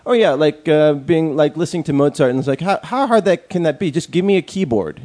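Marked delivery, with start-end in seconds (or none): 2.6-5.0 mock naive know-it-all. Mocking naive Mozart listener.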